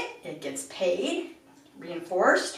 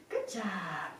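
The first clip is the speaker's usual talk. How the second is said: soft praise; 11 dB quieter than usual. Real, not acted. Calm praise for the puppy.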